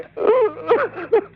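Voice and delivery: high-pitched wailing sob; hammed-up crying fit; sobbing. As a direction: break into high, stuttering sobs. Caller breaks down sobbing.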